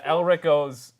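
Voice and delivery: usual speaking voice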